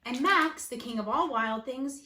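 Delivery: usual speaking voice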